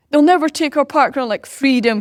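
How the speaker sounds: loud, high-pitched battle cry; rally the troops in a mock-heroic shout; Mel Gibson. Crowd defending their Park Run, Braveheart-style rallying cry. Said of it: "kind of Mel Gibson style".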